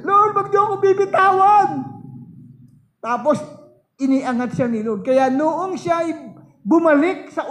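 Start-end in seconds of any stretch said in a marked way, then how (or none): 0.1-1.7 high, loud tearful begging; crying plea. Retold or imitated. Sister slipping toward the fire begs the Lord, crying. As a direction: retell her sobbing cry not to be let go. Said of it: "Sabi niya".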